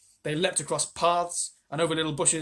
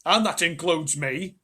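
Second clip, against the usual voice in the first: put-on old man voice; 3 semitones higher, 7 dB louder, slower than usual. Grandpa George speaking.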